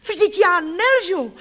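very high, loud and drawn out; slow piercing furious cry. A narrator's reading. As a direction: cry out in outrage, slowly. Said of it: "vykřikla jsem rozzuřeně a pronikavě".

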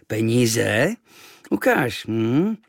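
lightly voiced greedy innkeeper; greedy interest. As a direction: perk up at the money. Innkeeper hears about money.

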